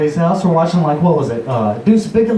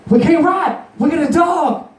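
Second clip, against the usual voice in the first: mock protest; 6 semitones higher than usual. Acted protest to buddy.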